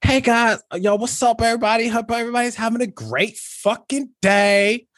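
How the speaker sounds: chirpy hello to your followers; imitating a friend's cheerful Instagram story; upbeat vlogger. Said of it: "she's talking like".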